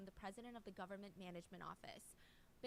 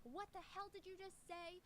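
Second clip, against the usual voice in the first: high, slow mock yell back; 느리고 높게 흉내 낸 화난 되받아치기; retold furious yell; 9 semitones higher than usual. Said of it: "She would scream back at them".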